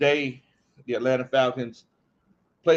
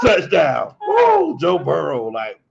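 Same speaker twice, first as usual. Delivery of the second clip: loud, high-pitched whoop; big hammed-up cheer; mock touchdown celebration; 3 semitones higher, 7 dB louder, slower than usual. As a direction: shout it like a gleeful scorer. Acts out opponent's touchdown celebration.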